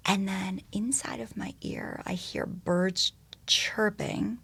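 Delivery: slow, soft whisper, almost breathless; hushed whisper. Acted. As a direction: whisper as if not to scare the birds. The moment the birds grounded her.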